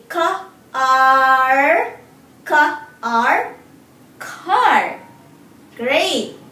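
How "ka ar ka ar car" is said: Ka and ar split, then blended into Car; sounding out words. Shown as a demonstration. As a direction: stretch Ka, ar, then join them. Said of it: "Let's say the sound slowly".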